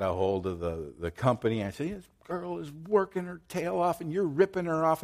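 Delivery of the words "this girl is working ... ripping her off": high, indignant retold telling-off; pitched-up mock indignation; retold angry rant. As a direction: act out scolding the phone agent, pitched up. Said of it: "and I'm yelling at her".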